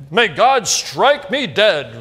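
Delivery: slow, loud oath to heaven; slow theatrical swearing; mock solemn oath. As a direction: ham up a dramatic vow.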